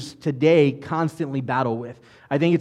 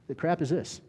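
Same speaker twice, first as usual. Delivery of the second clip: baffled muttering; 9 dB quieter than usual. Acted. Israelites looking at the manna they asked for, baffled. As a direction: quietly puzzle over it, unimpressed.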